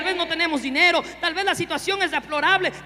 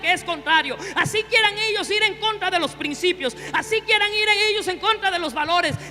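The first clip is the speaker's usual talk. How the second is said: forceful preaching; 5 semitones higher, 5 dB louder than usual. Acted.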